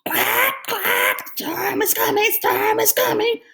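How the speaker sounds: very high quacking duck voice. A narrator's reading. Duck quacks the storm warning. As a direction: quack the words out. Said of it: "Duck quacked".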